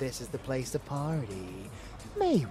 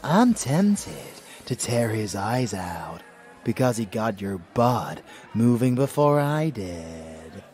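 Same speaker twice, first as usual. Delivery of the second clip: raised, teasing possessive voice; playful jealousy; 7 dB louder than usual. Acted. Lover jealous of the DJ, loud playful threat. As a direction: tease loudly with mock menace.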